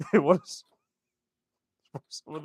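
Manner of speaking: laughing through the words. Real, not acted. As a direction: break into a laugh mid-line. Laughing at own joke.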